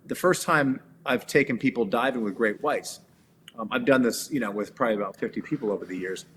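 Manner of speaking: usual speaking voice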